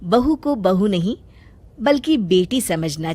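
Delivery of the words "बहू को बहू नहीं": plain reading voice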